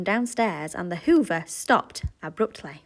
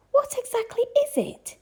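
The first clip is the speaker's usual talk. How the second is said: nervous. A narrator's reading. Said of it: "asked Missus Large nervously".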